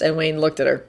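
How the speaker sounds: plain reading voice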